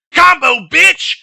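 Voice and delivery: imitating a drill instructor's high, loud shout; sudden full-voiced mock fury; in-your-face yell. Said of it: "Like, straight up in his face".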